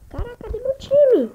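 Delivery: loud, high cry of dismay; shocked shout. Real, not acted. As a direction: blurt it out in alarm. Shocked outburst, team missing.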